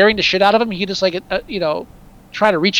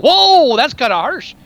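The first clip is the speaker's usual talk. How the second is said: surprised; 6 semitones higher, 5 dB louder, slower than usual. Retold or imitated. Audience reaction retold.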